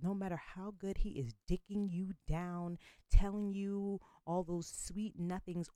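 usual speaking voice

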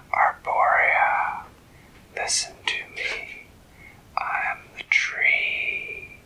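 slow, unvoiced whisper, sinking low at the end; an ancient tree speaking inside her head; eerie tree whisper. A narrator's reading. The old tree calls the fairy by her new name, whispering. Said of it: "an eerie whisper in her head".

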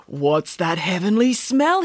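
slow, dreamy husband voice. A narrator's reading. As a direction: savour the smell slowly. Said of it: "he asked".

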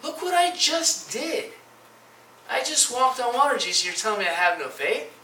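high-pitched indignant protest; offended. Acted. Peter answers Jesus after walking on water, offended. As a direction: protest as if wronged. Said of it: "reason to be offended at you".

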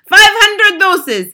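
slow, high, very loud witch's yell. A narrator's reading. Grand High Witch announces the doses, drawn-out yell. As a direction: yell it out with each word stretched. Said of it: "Grand High Witch yelling".

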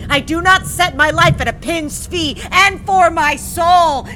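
loud, high theatrical defiance; hammy stage actor. Acted. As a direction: defy fate like a stage Hamlet. Defiant Hamlet speech to sister.

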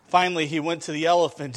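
normal talking voice

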